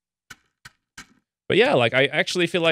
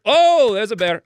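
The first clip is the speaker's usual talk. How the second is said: sudden high, loud "oh" at a bear; startled; 9 semitones higher, 6 dB louder than usual. Real, not acted. Gamer spotting a bear in her game, startled. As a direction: jump at the sight of a bear.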